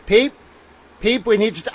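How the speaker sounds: ordinary talk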